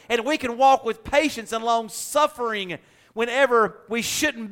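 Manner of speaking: rising, loud sermon voice; preaching. Acted. Pastor preaching to congregation, full-force sermon. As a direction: build it up like a pulpit climax.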